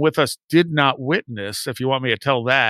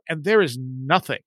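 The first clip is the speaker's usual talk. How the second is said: normal talking voice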